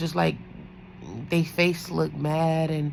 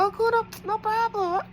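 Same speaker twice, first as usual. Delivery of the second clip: much higher, sing-song polite voice; high polite agreeing. Retold or imitated. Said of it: "So I was like".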